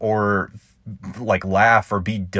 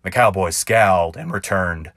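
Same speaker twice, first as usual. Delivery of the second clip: ballad narration; 4 semitones lower than usual. A narrator's reading. Narrator reading his cowboy ballad to listeners, rhythmic and sly. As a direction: spin it like a campfire tall tale.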